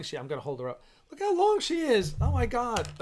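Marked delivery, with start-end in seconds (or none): 1.1-2.9 excited gush. Real, not acted. Showing off the grown puppy.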